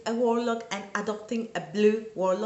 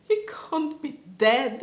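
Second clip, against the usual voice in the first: crying; 3 semitones higher, 3 dB louder than usual. Retold or imitated. First take, crying.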